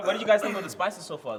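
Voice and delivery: ordinary talk, nothing marked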